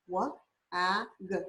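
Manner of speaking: ordinary delivery, nothing marked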